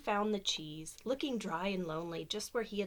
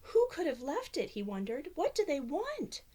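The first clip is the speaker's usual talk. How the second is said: worried; 7 semitones higher than usual. Acted. Mouse worries aloud.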